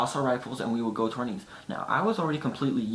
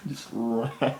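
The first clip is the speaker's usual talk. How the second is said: ordinary talk, nothing marked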